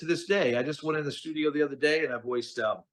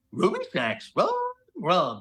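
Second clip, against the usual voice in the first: higher cartoon dog voice with rolling R sounds; Scooby-Doo; 6 semitones higher than usual. Scooby in the Super Bowl ad begging for snacks, cartoon dog voice. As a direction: bark out the words like a cartoon Great Dane. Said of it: "it's like".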